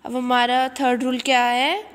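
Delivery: usual speaking voice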